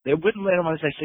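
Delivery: ordinary talk, nothing marked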